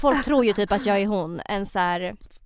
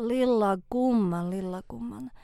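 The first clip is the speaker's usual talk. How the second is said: put-on slow Finnish-accented driver, drawn out and fading softer; Finnish old woman sound; 4 dB quieter, slower than usual. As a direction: draw out the Finnish accent slowly. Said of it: "låter fortfarande som en finsk kärring".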